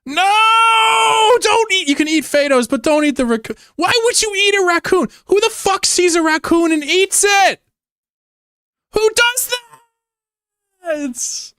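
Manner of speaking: a high, shouted squeal of protest; squealing outrage. Real, not acted. Streamer pleading with chat not to eat raccoons, shrill squeal. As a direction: beg them to spare the raccoon, squeaking.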